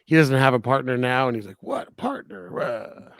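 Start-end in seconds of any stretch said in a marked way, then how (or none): 1.6-3.1 high, hushed mock protest; mock grumbling. Host retells the cop balking at his new partner, mock grumbling. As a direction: imitate a put-out cop, high and under the breath.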